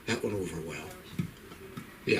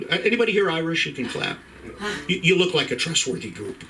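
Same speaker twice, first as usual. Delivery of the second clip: ordinary talk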